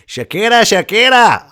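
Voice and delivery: high, loud name call; excited gushing. Real, not acted. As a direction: swoon over the name. Gushing over [name].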